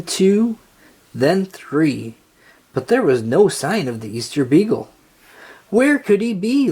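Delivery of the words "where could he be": high, anxious cry; worried cry. A narrator's reading. Linus worrying about the missing Easter Beagle, high and anxious. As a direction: fret aloud, pitched up. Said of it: "Linus cried".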